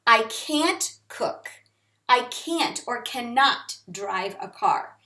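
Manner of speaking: slow, deliberate "can't" sentence; slow example. Shown as a demonstration. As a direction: read it slowly for learners. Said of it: "The negative would be can't".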